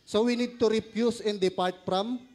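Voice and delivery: normal talking voice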